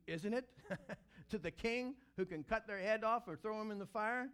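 ordinary talk

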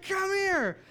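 loud, high-pitched playful shout; excited calling out. Retold or imitated. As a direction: call a child over at full volume. Dad calls to running child.

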